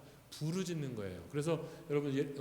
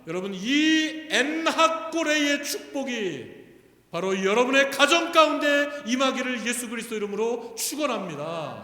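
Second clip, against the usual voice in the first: loud, high preacher's blessing; full-force blessing; 6 semitones higher, 11 dB louder than usual. Real, not acted. Preacher blesses the congregation's families, preaching at full force. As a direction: proclaim the blessing over them at full voice.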